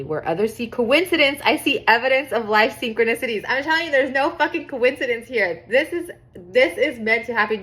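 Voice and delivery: emphatic, rising enthusiasm; getting excited. Real, not acted. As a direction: get carried away, insisting on every point. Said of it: "Sorry, I'm getting excited".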